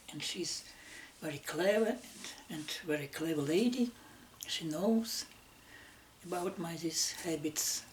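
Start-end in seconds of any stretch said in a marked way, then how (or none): none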